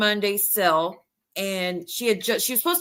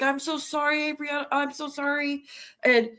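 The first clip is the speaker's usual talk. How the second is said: raised pitch, gently mock-upset; retold tearful apology; 7 semitones higher, faster than usual. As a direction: lightly mimic a distressed, pleading apology. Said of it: "people behind the camera are crying".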